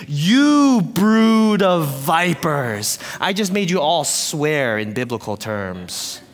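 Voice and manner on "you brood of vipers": very slow, loud denunciation; prophet's insult, each word stretched; John the Baptist rebuking. Shown as a demonstration. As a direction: lead a crowd in a drawn-out accusation. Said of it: "Can you say that with me?".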